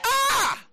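high, loud "Ah!"; loud exclamation. Real, not acted. Preacher struck by the verse he just read, loud cry. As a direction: cry out in a sudden high shout.

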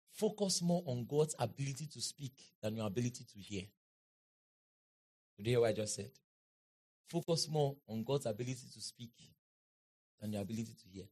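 low, soft, measured preaching; hushed solemn counsel. Real, not acted. Preacher closing with advice to the congregation, low and hushed. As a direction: drop low and soft to press the point.

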